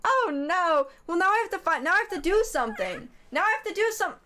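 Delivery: high-pitched, flustered fretting; flustered panic. Real, not acted. Streamer suddenly owing raiders a show, flustered panic.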